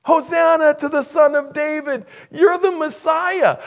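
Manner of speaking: high, loud jubilant cheer; children crying out. Retold or imitated. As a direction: cry out like excited kids hailing a hero. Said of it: "they continued to cry out".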